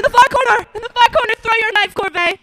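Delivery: high urgent yell, pointing out the corner; frantic call to Corbet; urgent shout. Acted. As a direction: shout the spot so he throws. Calls out the hiding place.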